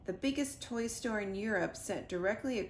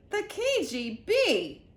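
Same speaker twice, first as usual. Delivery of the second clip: louder, higher, slow; shocked realisation; excited exclaim; 9 semitones higher, 7 dB louder, slower than usual. A narrator's reading. Mac exclaims.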